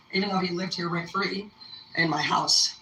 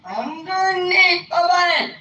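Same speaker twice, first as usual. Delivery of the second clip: high, drawn-out exclamation of disbelief; mock shock; 11 semitones higher, 4 dB louder, much slower than usual. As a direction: stretch it out in exaggerated shock. Acted shock at magazine find.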